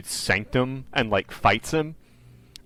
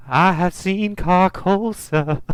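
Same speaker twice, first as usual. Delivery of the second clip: put-on high, slow, drawn-out old woman's voice; imitated older lady; 7 semitones higher, much slower than usual. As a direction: mimic a confused old witness, pitched up and slow. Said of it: "she's, like, an older lady".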